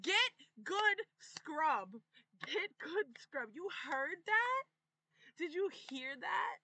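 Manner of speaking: high, loud jeering; loud taunt. Real, not acted. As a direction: call it out to taunt a beaten rival. Taunting the opponent with mocking catchphrase.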